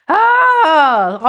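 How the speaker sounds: high, loud, pleased oh; light up with surprise; surprised oh. Real, not acted. Reader spots Lisa in the chat, surprised.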